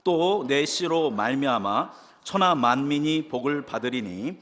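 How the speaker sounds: slow, measured Bible reading aloud; scripture reading. Acted. Pastor reading Genesis to the congregation, slow solemn reading. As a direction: read each phrase slowly like holy text.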